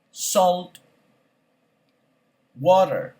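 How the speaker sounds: each word said alone, then a long pause to repeat; vocabulary drill. Shown as a demonstration. Teacher reads word list for class to repeat. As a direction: give the word and wait for the echo.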